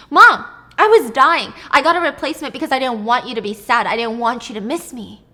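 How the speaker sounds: loud, desperate explanation; loud, desperate Sarah explaining herself to her mother; loud pleading. Acted. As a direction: push the excuse out loudly.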